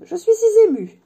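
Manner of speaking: very high, loud, emotional exclamation; overcome with emotion. Acted. Admirer moved at meeting Harry, high overwhelmed gushing. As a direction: gush as if overwhelmed.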